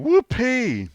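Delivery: high, loud hammed-up whoop; mock cheer. Host reading out one point above relegation, loud mock cheer. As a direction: let out a big celebratory squeal.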